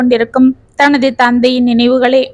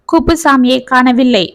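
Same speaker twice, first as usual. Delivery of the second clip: ordinary delivery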